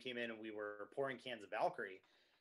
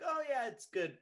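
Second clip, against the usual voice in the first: high, louder mock compliment; imitated polite praise; 11 semitones higher, 5 dB louder than usual. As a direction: put on a high-pitched customer's approval. Voices a lukewarm customer compliment.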